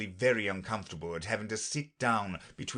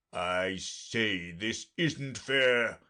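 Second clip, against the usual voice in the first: crying out; much slower than usual. A narrator's reading. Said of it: "cried the unicorn".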